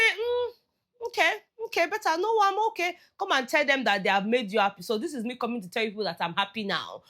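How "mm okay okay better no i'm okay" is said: high, light sing-song voice; lilting high okay, okay; mock sweet reassurance. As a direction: play the soothed, calmed-down one. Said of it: "Then I say".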